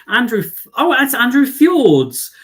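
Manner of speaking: a high, loud oh of delight; delighted surprise. Real, not acted. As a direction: greet a friend you just spotted. Recognising a viewer's name.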